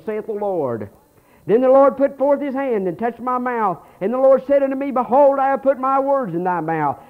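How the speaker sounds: loud, high fervent scripture reading; preaching scripture reading. Acted. Pastor reading Jeremiah aloud to his church, fervent preaching. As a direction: proclaim the verses to the congregation. Reads God's reply at full force.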